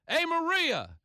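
loud, high hammed-up Bronx guy yelling at his girl; Bronx Italian yelling. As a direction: bellow it like a streetwise Italian from the Bronx calling his woman. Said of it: "you could hear the sounds of Italian men romancing their women".

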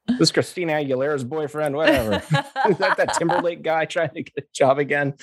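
high-pitched, laughing mock reaction; mocking imitation. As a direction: push it up high, half laughing. Mocking imagined reaction to résumé.

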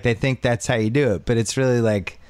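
ordinary delivery

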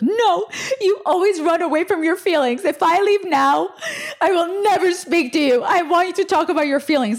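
high, mock tearful outburst; imitated bawling. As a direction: play the dramatic friend wailing an ultimatum. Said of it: "She starts bawling".

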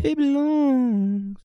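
high, slow squeaky falsetto. Pretend.

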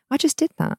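high, whiny little voice; high petulant voice. Retold or imitated. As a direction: whine like a child wanting praise. Retold thought wanting praise.

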